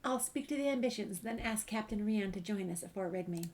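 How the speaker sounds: ordinary talk, nothing marked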